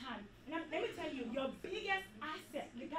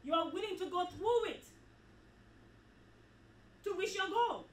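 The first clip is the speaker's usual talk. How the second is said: loud, rising sermon climax; preaching at full force; 2 semitones higher, 4 dB louder than usual. Acted. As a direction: preach it out, building with each line.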